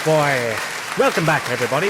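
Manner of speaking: usual speaking voice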